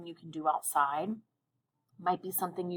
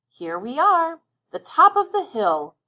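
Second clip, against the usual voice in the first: excited, much higher and louder yell; Eve yells; 9 semitones higher, 9 dB louder than usual. Acted. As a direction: call out joyfully from the hilltop. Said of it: "Eve yells".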